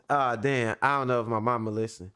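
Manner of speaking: usual speaking voice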